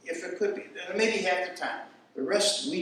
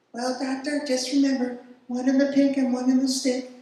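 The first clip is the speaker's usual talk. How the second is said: a light, higher-pitched imitation of the nurse; 7 semitones higher, 5 dB louder than usual. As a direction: lightly play the wise old nurse passing on a trick. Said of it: "that's Kool-Aid, not, not me".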